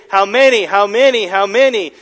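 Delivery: HOW MANY repeated loud with MANY punched; emphatic repetition. Shown as a demonstration. Quotes repeated phrase with force.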